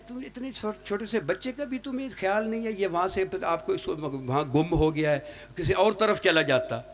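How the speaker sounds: high, hushed scolding wife's voice. Retold or imitated. Wife scolds husband about child.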